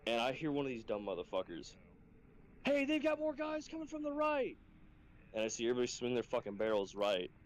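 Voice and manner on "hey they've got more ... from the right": very high mock-panicked trainee voice; imitated alarmed callout. Trainee warning squad about guys on the right, mock alarm. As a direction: call a false alarm in a silly falsetto.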